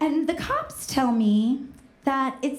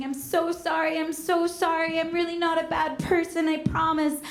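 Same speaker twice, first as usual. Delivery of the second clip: high, pleading mock-crying; hammed-up sobbing apology, no real tears; tearful apology; 5 semitones higher than usual. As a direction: beg forgiveness in a pitched-up whine. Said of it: "I just burst into tears".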